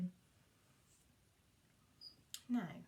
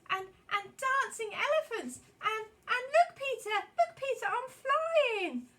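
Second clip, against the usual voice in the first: much higher and louder, breathless delight; thrilled cry; 11 semitones higher, 10 dB louder than usual. Acted. Wendy calling to Peter as she flies, thrilled and loud. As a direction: squeal it as she lifts off.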